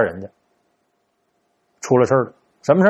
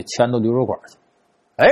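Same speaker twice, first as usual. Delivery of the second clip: normal talking voice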